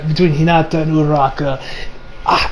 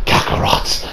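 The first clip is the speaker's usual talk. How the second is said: soft farewell; 4 semitones lower, 5 dB quieter, slower than usual. Retold or imitated. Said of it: "And he says".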